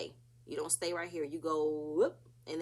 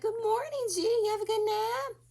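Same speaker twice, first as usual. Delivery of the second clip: mock sweet group greeting; 5 dB louder than usual. The group teases G, who just woke from his nap, in a high sing-song. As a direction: coo it like teasing a sleepyhead.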